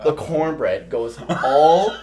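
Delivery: usual speaking voice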